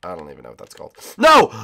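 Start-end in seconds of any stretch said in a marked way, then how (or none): 1.2-1.5 loud, high outburst; dismayed shout. Real, not acted. Gamer suffers costly setback, dismayed shout. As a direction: cry out at a sudden mishap. Mishap in the race.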